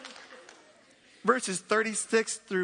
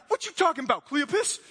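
higher, louder scoffing disbelief; a sassy what-are-you-on-about; disbelieving disciples questioning Cleopas; incredulous; 6 semitones higher, 3 dB louder than usual. Acted.

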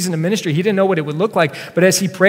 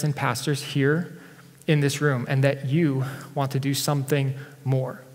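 vague prayer. Retold or imitated. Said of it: "I pray super generally like this".

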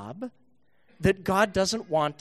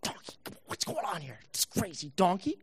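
much softer, whispery grumble; exasperated Balaam; 8 dB quieter than usual. Acted. Balaam grumbling at his donkey, soft and whispery. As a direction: hush it down, fed up. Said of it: "beats the donkey and says".

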